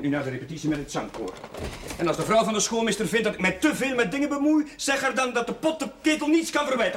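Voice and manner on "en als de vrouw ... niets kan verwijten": fast, higher and louder rebuke; rapid indignant comeback; sharp retort. Acted. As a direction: fire it back, then walk out. Priest snaps back leaving.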